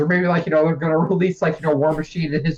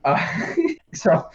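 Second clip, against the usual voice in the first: ordinary delivery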